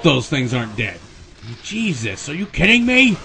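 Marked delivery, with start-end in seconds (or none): 1.6-3.2 high, loud disbelief at the game; exasperated outburst. Real, not acted. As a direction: snap it out in frustration. Frustrated at enemies not dying.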